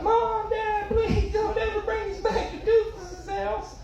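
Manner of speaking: put-on high, shouted child's plea; begging kid voice. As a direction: wail like a scared kid. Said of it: "They're gonna".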